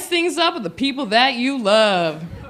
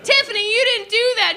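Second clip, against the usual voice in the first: put-on kid voice; 11 semitones higher than usual. Kid voice at recess.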